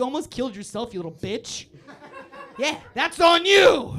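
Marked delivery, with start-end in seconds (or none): 2.6-3.9 loud, slow, higher blame; accusing shout. Acted. Comic blaming reckless driver, shouted accusation. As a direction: throw the blame back hard.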